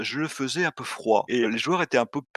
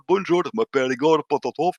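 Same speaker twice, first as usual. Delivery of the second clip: put-on Russian accent slipping into a Marseille twang; lightly done Russian-Marseille accent; 2 semitones higher, 4 dB louder than usual. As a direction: imitate a Russian accent with Marseille vowels underneath. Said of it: "avec un accent russe, mais il y avait un vieux retour marseillais".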